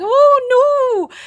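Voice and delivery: high, loud drawn-out wail; cartoonish cry of dismay; dismayed vendor. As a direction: wail in mock horror over ruined goods. Voicing the robbed ice cream seller.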